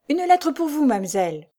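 high-pitched call to someone passing. A narrator's reading. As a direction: call out to catch her as she passes. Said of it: "lui cria". (interpellation lancée d'une voix haute)

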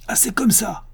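understated roar, pitch jumping well up; mild roar. A narrator's reading. Accused husband rebelling at his wife's betrayal, restrained roar. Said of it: "rugit-il".

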